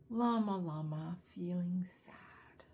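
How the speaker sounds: usual speaking voice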